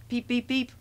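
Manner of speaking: high little train peeps; whistling engine. A narrator's reading. Thomas answering Gordon's hoot, high whistled peeps. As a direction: imitate a small high whistle. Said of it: "whistled Thomas".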